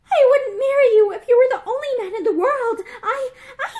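high, furious, trembling voice; acted outburst, voice shaking and rising; shaking with anger. A narrator's reading. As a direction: build to a loud, stammering, angry rejection. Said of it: "Her voice shook with anger now".

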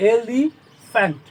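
Elephant slowly broken as e-li, fant; syllable by syllable. Shown as a demonstration. Teacher counting three syllables, elephant said slowly in pieces. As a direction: draw out elephant with a pause before fant. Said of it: "মানে তিনটে".

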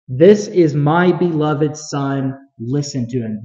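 very slow, weighty proclamation; slow, solemn repeat, letting each word land; voice from heaven. Acted. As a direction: draw out every word as a divine declaration. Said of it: "the weight of being able to hear that".

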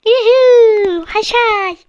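high loud cheer; excited whoop. Real, not acted. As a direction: whoop in triumph. Celebratory whoop.

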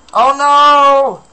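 sudden high, loud yelp of alarm; real shock, cry it out. Real, not acted. Danger suddenly appears in game.

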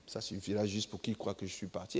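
a lazy demon smoking, pleased with its trick; quiet, smug demon muttering to itself; sly demon voice. Retold or imitated. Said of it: "Et puis, il dit".

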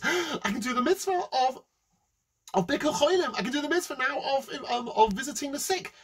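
high, eager, thrilled realisation; hammed-up delight. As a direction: gush at a chance to score points. Rich man delighted at another mitzvah.

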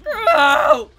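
very loud, high mock yell; imitated man yelling. Player showing the dinosaur's landing sound, yelling. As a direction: mimic a man's shout, high and full-out. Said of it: "sound like a man yelling".

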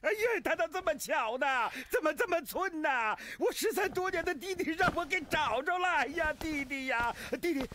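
high, emotional exclamations, faltering in disbelief; overwhelmed reunion. Acted. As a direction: cry out as if finding a long-lost brother. Acted reunion with long-lost brother. (激动万分，高声认亲)

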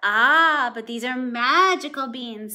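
man bursting out loudly about magic beans; loud mysterious ah. A narrator's reading. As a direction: lure him in with magic beans. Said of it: "said the man".

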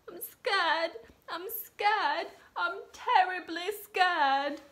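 high, trembling frightened cook voice; scared cook. Acted. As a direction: squeak it out like a terrified little cook. Scared cook repeats his fear.

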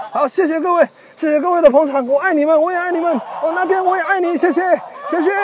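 high-pitched mock idol thanks; shower the fans with love, pitched high; playful star gushing. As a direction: pop star thanking an imagined crowd. Playing star to adoring fans.

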